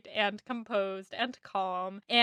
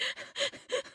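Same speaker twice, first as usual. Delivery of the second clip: breathy squeal; 10 dB quieter than usual. Shown as a demonstration. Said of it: "all I'm doing in this episode is being like".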